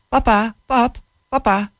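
put-on a touch of a frightened child; child calling papa. Child calls fallen father.

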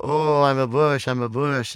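high, hammed-up silly delight; playing along. Speaker plays along with child, high mock excitement. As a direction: play it up for a small child. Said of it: "And I go".

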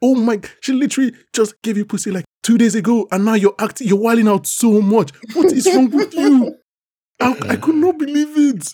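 high, rising outraged scolding; fuming at the guard character, voice climbing high; exasperated rant. Real, not acted. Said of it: "I swear he wants to flog this guy".

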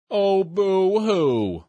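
slow, sneering fake pity; mocking boo-hoo. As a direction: drag it out to taunt her. Bugs sneers at Cindy.

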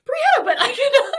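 high, loud laughing complaint; laughing protest. Retold or imitated. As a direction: squeal it through laughter. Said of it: "You're like".

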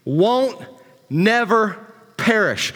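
"won't never perish" slow, each word leaned on; stress on never. Shown as a demonstration. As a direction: lean hard on "never" and draw out "perish". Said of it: "it emphasizes here that".

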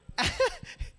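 sudden high-pitched laugh. Real, not acted. Preacher laughs at own story.

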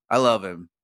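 normal talking voice

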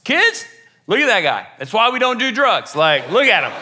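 high opening call, then mock-scolding mom; hammed-up disapproving mother; minivan mom. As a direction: imitate a mom warning the kids. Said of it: "hear the mom in the minivan".

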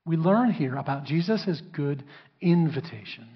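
ordinary talk

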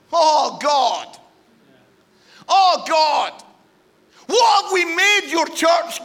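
loud, high anguished plea to God; real fervent preaching shout; impassioned cry. Real, not acted.

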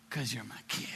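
soft, low, breath-only answer; tender whisper. Acted. God explaining love to his child, tender whisper. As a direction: hush right down to a whisper. God's hushed answer to why.

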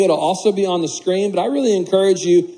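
ordinary talk, nothing marked

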